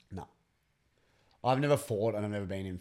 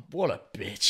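lightly voiced disgusted dad; mock dad voice; 4 dB louder than usual. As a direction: sneer like a judging dad. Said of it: "just goes".

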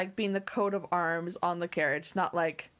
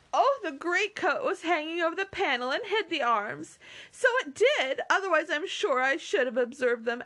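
higher, animated explaining; excitable Mary; 9 semitones higher than usual. Acted. Mary explaining why she missed the arms, excited. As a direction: explain eagerly in a high character voice.

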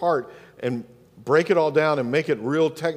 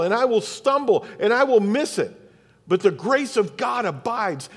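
higher-pitched, emphatic declarations, settling at the end; fervent preaching; 5 semitones higher than usual. Real, not acted. Pastor declaring his goal to the congregation, fervent preaching. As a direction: declare it with full conviction.

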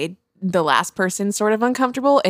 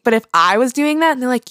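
ordinary talk, nothing marked

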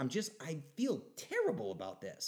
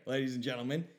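ordinary talk, nothing marked

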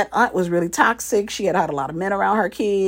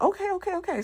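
startled okay; 11 semitones higher, 7 dB quieter, faster than usual. Retold or imitated. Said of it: "he was like".

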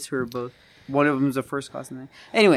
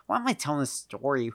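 normal talking voice

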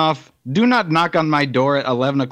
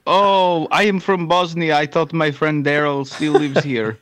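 put-on Bosnian accent. Visitor explaining he seeks his friend Daryl, Bosnian accent. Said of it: "do a Bosnian accent".

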